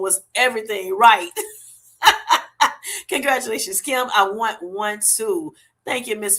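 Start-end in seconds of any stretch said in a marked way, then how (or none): 2.0-3.0 loud high laugh; burst of laughter. Real, not acted. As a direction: laugh out loud, genuinely tickled. Laughing at a viewer's joke.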